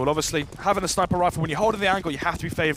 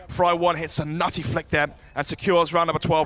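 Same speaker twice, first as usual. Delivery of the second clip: ordinary delivery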